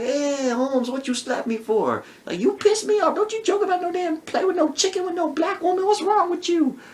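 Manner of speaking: high whiny complaint. Retold or imitated. Man voiced, high complaint.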